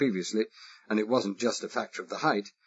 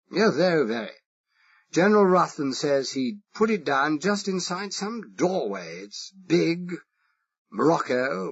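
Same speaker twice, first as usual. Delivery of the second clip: put-on higher, drawn-out upper-class English accent; exaggerated posh English accent; 6 semitones higher, slower than usual. Spy asking the Irish foreman, exaggerated posh English accent. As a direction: play the harmless toff with a marked English accent. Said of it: "I exaggerated it".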